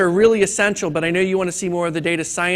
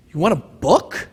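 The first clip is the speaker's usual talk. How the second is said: lightly voiced puzzled librarian; a hint of a baffled clerk asking; 2 semitones lower than usual. Librarian questions the clucking chicken, lightly puzzled.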